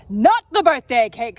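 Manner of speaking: loud protest; alarmed mother. Acted. Mother shouting to protect the birthday cake, alarmed. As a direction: cry out to save the cake. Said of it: "says Mother".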